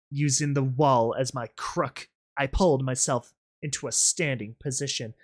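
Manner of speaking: ordinary delivery, nothing marked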